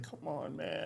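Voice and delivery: very soft retold exasperation; muttered inward complaint. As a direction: grumble it under your breath. Retells his silent reaction.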